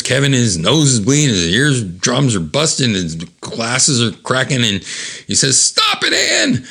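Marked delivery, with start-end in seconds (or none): 5.6-6.7 very high-pitched yell; desperate mock shout. Kevin begs Ann to stop her blast, high shout.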